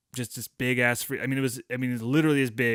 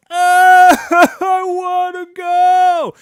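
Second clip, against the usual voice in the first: much higher and louder, slow wailing shout through a laugh; panicked wail; 14 dB louder, much slower than usual. Retold or imitated. Terrified kid begging to leave, laughing wail. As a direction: wail to get away, half laughing.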